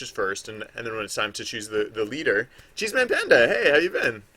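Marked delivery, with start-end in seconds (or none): 2.7-4.2 high, loud happy hello; excited greeting. Real, not acted. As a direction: call out to a friend brightly. Greets a chat viewer.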